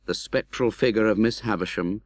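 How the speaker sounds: normal talking voice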